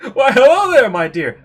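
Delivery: loud, high, showy hello; effusive greeting. Acted. Eric greeting a stranger, loud and effusive. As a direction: greet grandly at full volume.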